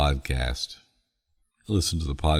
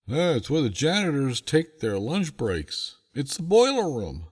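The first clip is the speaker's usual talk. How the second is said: a lightly voiced janitor, pitched well higher; janitor's voice; 11 semitones higher than usual. Janitor tells the grown man it is the boiler room, pitched higher. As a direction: play the casual janitor explaining.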